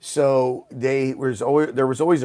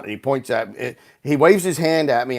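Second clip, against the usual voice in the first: usual speaking voice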